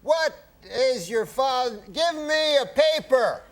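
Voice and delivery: high, very slow Cosby drawl; Bill Cosby impression. Guest doing Cosby for the host, high and slow. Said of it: "And, uh, he goes".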